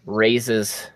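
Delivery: normal talking voice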